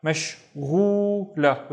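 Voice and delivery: "Mashghula" slowly, the syllables brought closer together; slow whole-word model of "Mashghula"; joined syllables. Shown as a demonstration. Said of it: "splitting this into syllables".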